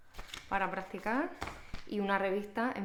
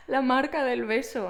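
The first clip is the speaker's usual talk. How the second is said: laughing; 4 semitones higher, 6 dB louder than usual. Real, not acted. Laughing over kiss mark.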